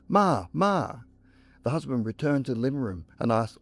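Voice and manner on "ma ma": high, repeated call for mother; yelling. A narrator's reading. Husband running into the hallway after his mother, yelling. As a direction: call out after her, pitched well up. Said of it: "ran into the hallway yelling".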